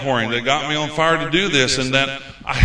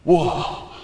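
breathy mock whoa; 5 semitones higher than usual.

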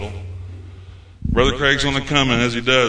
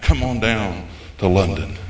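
ordinary talk

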